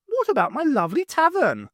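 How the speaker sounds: high, quick voice of a bossy cook; cook character voice. Acted. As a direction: play the cook, high and brisk. Nora the cook speaking.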